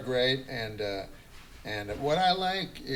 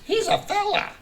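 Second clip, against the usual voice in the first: much higher squeaky tag line; mock fella voice; faster than usual. [name] plugging Kelsey's podcast, squeaky mock tag line. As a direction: squeak it out as a joke.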